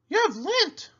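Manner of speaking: a llama giving friendly advice; Cherry Sue voice. A narrator's reading. Llama advising her friend the pig, high and sweet. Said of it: "said Cherry Sue".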